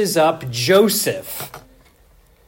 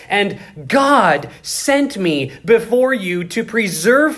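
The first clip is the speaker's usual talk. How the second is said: preaching at full force; 5 semitones higher than usual. Acted. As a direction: proclaim Joseph's words to the congregation. Preaching Joseph's speech.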